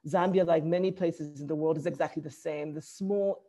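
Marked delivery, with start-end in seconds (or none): none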